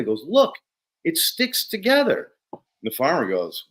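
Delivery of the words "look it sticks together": excited. Retold or imitated. Excited demonstration.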